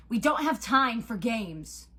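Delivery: slow, loud, emphatic rebuke; impatient demand. A narrator's reading. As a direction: push back hard against the taunting. Nico impatient with the taunting god.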